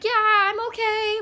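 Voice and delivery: high, slow forced-cheerful reply; faking okay. Retold or imitated. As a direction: pretend everything is fine while panicking. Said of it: "full on anxiety attack".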